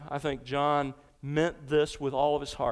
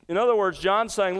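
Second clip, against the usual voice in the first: ordinary talk, nothing marked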